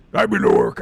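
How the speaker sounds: deep, loud orc line. Retold or imitated. Voice actor testing orc line, deep and loud. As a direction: boom it out like a brute. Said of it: "trying to remember what he sounded like".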